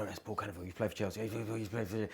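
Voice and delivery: very soft, low hushed gossip; awed aside under the breath; onlookers murmuring. Retold or imitated. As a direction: imitate a crowd nudging each other quietly. Imitates onlookers whispering about a celebrity.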